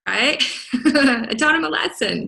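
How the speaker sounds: usual speaking voice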